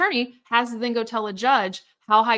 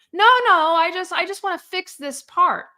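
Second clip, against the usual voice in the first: high lawyer voice; 7 semitones higher, 5 dB louder than usual. Retold or imitated. Larry's reply voiced.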